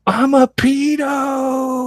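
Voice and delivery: very high, slow drawn-out scream; screamed tic. Retold or imitated. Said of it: "like, as loud as she could".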